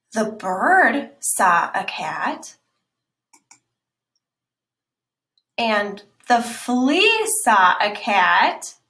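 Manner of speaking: slow, loud stress on "fox"; slow storybook emphasis; slow emphatic reading. Shown as a demonstration. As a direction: draw out "fox" and land "saw a cat". Reading italic lines slowly.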